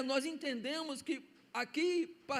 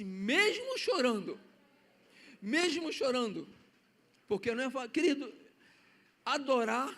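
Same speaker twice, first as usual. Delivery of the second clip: fervent preaching; 4 dB louder than usual. Acted. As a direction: press each phrase on the church. Preaching to the congregation.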